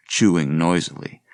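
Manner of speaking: usual speaking voice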